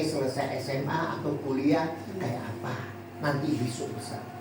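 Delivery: hushed anxious mother at night; worried mother. Retold or imitated. Mother tells daughter her fears, low and soft. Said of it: "sang mama bilang begini".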